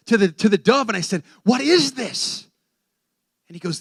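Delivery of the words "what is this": slow, loud, higher-pitched question; horrified demand. Retold or imitated. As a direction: cry it out in stunned horror. Acts his horrified question to dove.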